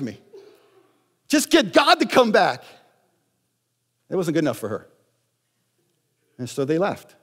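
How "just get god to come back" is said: high, loud punchline of the sermon; emphatic proclamation. Real, not acted. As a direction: hit it like the whole point.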